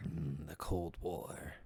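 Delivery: slow, soft Snake imitation; a weak Solid Snake voice. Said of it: "My snake voice is off".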